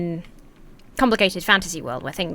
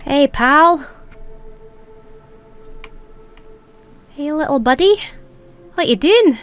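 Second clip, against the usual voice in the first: put-on playful Scottish accent, higher pitched; lilting, louder Scottish greeting; 8 semitones higher, 4 dB louder than usual. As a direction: call out to a little pal in a Scottish accent. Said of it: "started talking in a Scottish accent".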